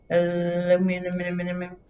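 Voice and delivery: aluminum with its middle syllables looping in a monotone; running-on joke pronunciation. Pretend. Streamer joking on both pronunciations, looping the syllables of aluminum. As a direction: chant aluminum as a tongue-tripping run of extra "minum"s. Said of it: "we have the joke of aluminum".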